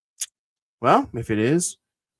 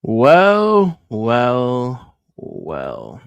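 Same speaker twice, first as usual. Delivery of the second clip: gloating well well well; 6 dB louder, much slower than usual. Real, not acted. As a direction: savor it like a villain. Gloating at arrested rival.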